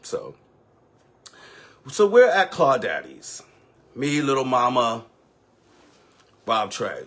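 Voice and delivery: normal talking voice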